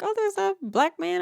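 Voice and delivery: high-pitched mock Karen; alarmed Karen. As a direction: imitate a Karen calling the cops. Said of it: "like, the Karens".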